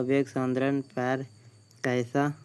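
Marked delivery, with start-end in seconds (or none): none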